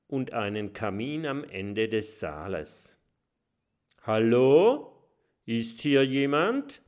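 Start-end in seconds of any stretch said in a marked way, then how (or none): 4.0-6.8 loud call into the empty hall; hesitant call into a dark castle. A narrator's reading. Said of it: "ruft Kokosnuss".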